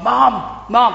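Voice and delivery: put-on excited wee Scottish boy, accent only sketched; Scottish accent attempt. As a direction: try a Scottish lad calling his mum, then give up. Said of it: "I can't do the accent".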